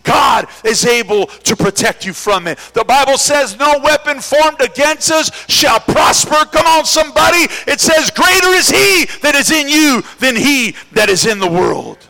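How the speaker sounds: building from firm to a shouting climax; preaching at full force. Acted. Preacher rallying the congregation at full shout.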